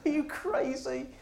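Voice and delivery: high, breathy disbelief; can't believe his ears; incredulous. Retold or imitated. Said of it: "I went".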